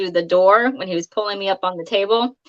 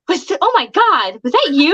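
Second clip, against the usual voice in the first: stunned; 6 semitones higher, 4 dB louder than usual. Retold or imitated. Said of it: "everybody's come running out of the house going".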